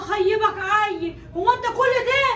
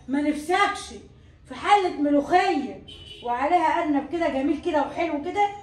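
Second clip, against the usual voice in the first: tempting; 5 semitones lower than usual. Acted. Tempting husband with food.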